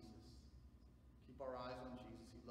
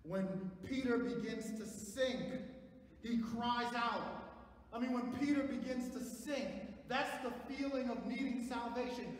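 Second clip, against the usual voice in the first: loud, high, slow proclamation; full-force preaching; 12 semitones higher, 12 dB louder, slower than usual. Acted. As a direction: ring it out to the congregation.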